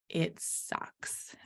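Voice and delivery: hushed, rueful whisper; whispered aside. Real, not acted. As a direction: drop to a whisper. Aside about failure being uncomfortable.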